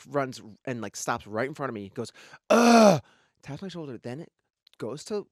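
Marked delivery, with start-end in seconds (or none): 2.5-3.0 loud, high exasperated grunt. Retold or imitated. Imitating the runner blocking his way, loud grunt. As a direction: blurt a big "ugh".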